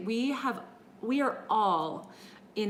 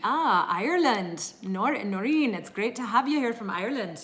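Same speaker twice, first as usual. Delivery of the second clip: lilting, badly faked Irish accent; terrible Irish accent; 3 semitones higher, 5 dB louder than usual. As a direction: greet warmly in a hammy Irish brogue. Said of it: "that was a terrible accent".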